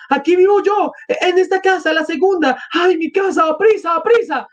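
very high, urgent entreaty; acted desperate high plea; pleading. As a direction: beg them to hurry, voice pitched high. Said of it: "suplicaba Sonia".

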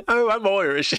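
high-pitched mock Irish accent; attempted Irish accent. Shown as a demonstration. Fan who can't do accents attempting mock Irish. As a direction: badly fake an Irish accent. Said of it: "how to do an Irish accent".

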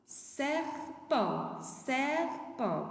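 serpent drawn out in two long syllables; syllable-by-syllable model. Shown as a demonstration. Teacher shows pupils the syllables in serpent, syllable by syllable. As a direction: stretch each syllable of serpent for learners.

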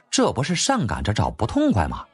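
ordinary delivery, nothing marked

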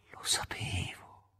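slow, hushed whisper; knowing whisper. Acted. Ivan learns his neighbour died, slow knowing whisper. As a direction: breathe it, knowingly.

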